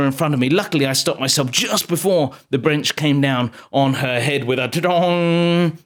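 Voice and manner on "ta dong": long ringing impact noise; imitated bonk. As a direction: let the dong ring out slowly. Imitates bench striking a head.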